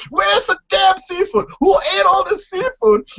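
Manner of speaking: hammed-up shouting at the house; mock furious yell. Retelling Gordy freaking out over missing seafood, high yell.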